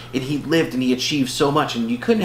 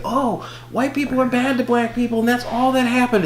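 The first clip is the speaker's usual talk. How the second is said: high-pitched mock complaint; mocking whine; 12 semitones higher than usual. Voicing those who separate everybody, high mocking tone.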